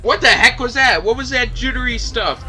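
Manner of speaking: loud, high, incredulous demand; exasperated outburst. Real, not acted. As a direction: burst out in disbelief, pitch up. Outburst at jittery slow motion.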